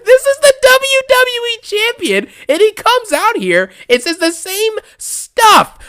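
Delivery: high-pitched, laughing through the words. Real, not acted. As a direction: squeak it out mid-laugh. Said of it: "laughs, retakes line".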